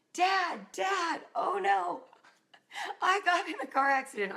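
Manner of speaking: high, rushed, mildly tearful cry to dad; retold sobbing plea. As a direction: reenact bursting in upset, not full sobs. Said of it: "I'm sobbing".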